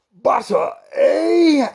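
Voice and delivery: very high, loud joyful cry; delighted. Acted. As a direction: burst out with joy. King delighted.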